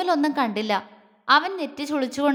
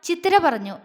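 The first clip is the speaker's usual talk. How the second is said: plain reading voice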